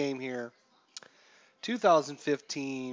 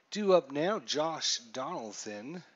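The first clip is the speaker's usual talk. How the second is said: ordinary talk, nothing marked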